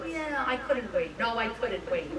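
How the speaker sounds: very soft retold reply; quiet, held-back imitation; imitated companion. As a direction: say it small and hushed. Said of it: "she was kind of like".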